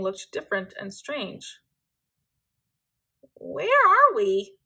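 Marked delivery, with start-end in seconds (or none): 3.3-4.6 high, slow, louder frightened wail; lost little hare wailing in fear. A narrator's reading. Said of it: "wailed Hugo".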